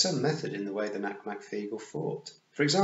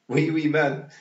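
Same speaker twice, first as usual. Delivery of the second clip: put-on loud, raised toddler chant; gleeful shout; 6 semitones higher, 9 dB louder, slower than usual. A narrator's reading. Wentworth delighted by the tiny man, gleeful shout. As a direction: chant it loudly with childish glee. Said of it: "shouted Wentworth gleefully".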